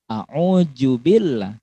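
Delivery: slow "a'udzubillah" with a j; wrong j sound. Shown as a demonstration. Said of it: "pakai huruf jim, itu keliru". ("a'udzubillah" salah dengan jim)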